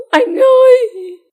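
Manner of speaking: gasp, then a very high, slow, crying shout; sobbing scream. A narrator's reading. Wife collapsing into a crying scream for her husband. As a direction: break into a wailing cry. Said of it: "tiếp tục gào lên".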